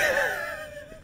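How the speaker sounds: nervous laugh-cry. Real, not acted. Nervous reaction in horror game.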